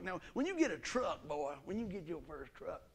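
normal talking voice

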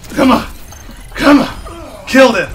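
loud, high bursts; punchy, excited gamer yells; urgent shouting. Real, not acted. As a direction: shout it in the heat of battle. Urging on a kill in game.

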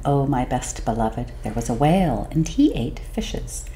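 whispered aside to the listener; hushed. A narrator's reading. Storyteller whispering to her best beloved. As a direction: breathe it like a secret.

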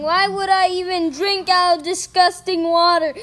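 ordinary talk

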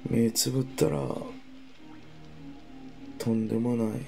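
very soft drowsy mumble; fading off to sleep; sleepy. Real, not acted. Falling asleep drunk, late. (眠そうな小声)

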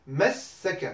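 masaka with the s held long, massaka; doubled middle consonant. Shown as a demonstration. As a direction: press on the s in the second syllable of masaka. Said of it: "on appuie un peu sur la deuxième".

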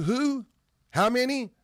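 high ringing question to the crowd; rhetorical question. Real, not acted. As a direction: call it out high to the congregation.

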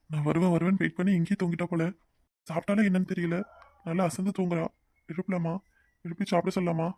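hushed musing. Acted. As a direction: keep it down beside someone sleeping. Husband talks over sleeping wife. (தூங்குபவர் அருகே மெதுவான தனிப்பேச்சு)

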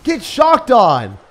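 loud gasp and slow triumphant taunt; real hyped taunt; excited gasp then gloat. Real, not acted. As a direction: gloat loudly at the opponent. Gloating after good play.

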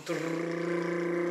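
slow rolled rumble imitating a quake; rumbling trill. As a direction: roll the r like the earth shaking. Imitates the rumble coming back.

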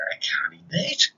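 a frowning, breathless whisper; dog whispers with a frown. Acted. The dog finds an old shoe, a frowning whisper. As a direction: hush it like a disappointed dog. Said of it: "said the dog with a frown".

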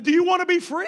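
ordinary delivery

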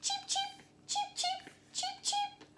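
tiny, very high, soft cheeps; chick voice. Pretend. Teacher showing children a little chick voice, very high and soft. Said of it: "can you do a little chick voice".

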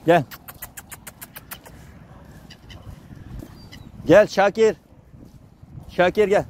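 coaxing animals. Real, not acted. Feeding timid strays, calling them over gently, high sing-song coaxing. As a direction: coax a shy stray dog to come close.

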